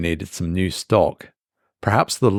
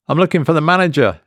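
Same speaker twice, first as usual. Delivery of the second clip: higher, quicker, a little eager; voice rising; 7 semitones higher, 4 dB louder, much faster than usual. A narrator's reading. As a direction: ask fast with the voice lifting up. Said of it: "his voice rising slightly".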